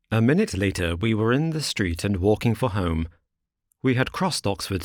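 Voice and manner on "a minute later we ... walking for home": ordinary delivery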